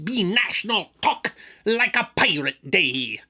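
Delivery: slow, drawn-out pirate announcement. Acted. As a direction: proclaim it like a pirate captain. Pirate voice vs plain later.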